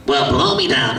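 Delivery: gruff sailor cartoon voice; Popeye voice. Shown as a demonstration. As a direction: growl it out like an old sailor. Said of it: "my Popeye".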